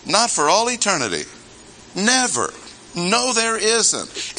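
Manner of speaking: high-pitched, vehement denial; emphatic negation. Shown as a demonstration. Preacher stressing there is no injustice with God, emphatic negation. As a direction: rule it out completely, voice pitched high. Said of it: "an emphatic negation, as strong as you can put it".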